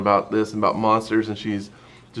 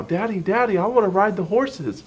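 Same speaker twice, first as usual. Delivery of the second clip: put-on high little-girl voice, pleading; two-year-old daughter; 11 semitones higher than usual. Father imitating his small daughter begging to ride the horses, high and pleading. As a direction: squeak it up like a toddler begging. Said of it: "she starts talking about how".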